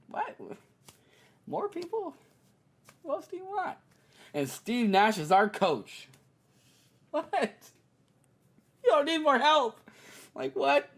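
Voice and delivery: very high, incredulous what; mock disbelief. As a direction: act stunned in disbelief. Incredulous at more signings.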